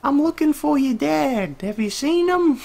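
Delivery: loud, very high falsetto, drawn out; mom yelling from afar. Acted. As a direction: call back like a mom in a distant room. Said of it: "that's what her mom sounds like in the distance".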